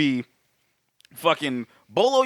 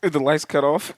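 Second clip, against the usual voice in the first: ordinary talk, nothing marked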